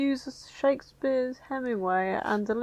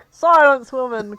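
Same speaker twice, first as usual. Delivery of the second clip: loud, commanding Garfield barking an order; hammed-up masculine command; 2 semitones higher, 10 dB louder than usual. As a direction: boom it out like a macho hero. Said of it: "commanded Garfield with masculine authority".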